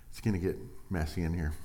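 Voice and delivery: usual speaking voice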